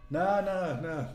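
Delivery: ordinary talk, nothing marked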